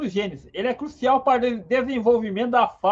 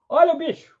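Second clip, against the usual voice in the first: raised alarm cry pointing at danger; warning call; 5 semitones higher, faster than usual. Pretend. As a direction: call out to warn the group. Alerting the group to danger. (grito de alerta)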